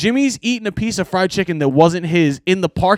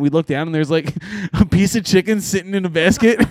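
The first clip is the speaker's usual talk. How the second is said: ordinary talk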